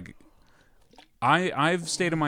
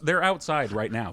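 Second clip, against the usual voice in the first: ordinary talk, nothing marked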